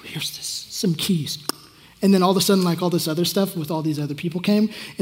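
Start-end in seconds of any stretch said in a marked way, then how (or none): 0.0-1.4 unvoiced slow whisper. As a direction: hush it like a secret. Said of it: "Bobby Conner was like".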